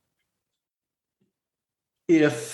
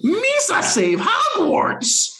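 much higher, slow, laughing Gungan voice; Jar Jar impression; slower than usual. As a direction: squeal it like Jar Jar Binks. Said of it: "the bubble shield that the Gungans".

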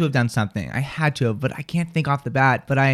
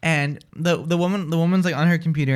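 ordinary talk, nothing marked

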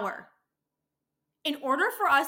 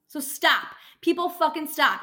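higher and louder, a real angry demand; fed-up outburst; 5 semitones higher, 6 dB louder than usual. Real, not acted. Dental worker scolds rude patients, loud and angry. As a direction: tell them to stop, really meaning it.

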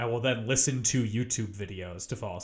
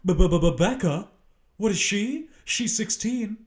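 mock dramatic reveal; 8 semitones higher, 5 dB louder, slower than usual.

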